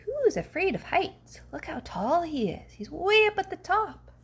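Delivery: lightly voiced chattering monkeys. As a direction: play the monkeys teasing. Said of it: "these monkeys say".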